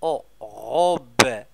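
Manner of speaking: drawn-out blending for pupils; slow sound blending. Shown as a demonstration. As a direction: stretch out the sounds then join them. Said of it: "blends sounds slowly".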